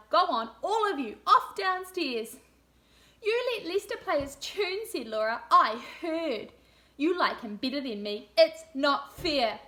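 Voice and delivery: put-on lively read-aloud for young children; storybook reading. A narrator's reading. Teacher reading a story to room two, lively storybook voice. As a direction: read it like a picture book to a class.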